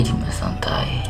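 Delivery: low, hushed self-talk; calming himself. Acted. Player tells himself to relax, hushed and calming.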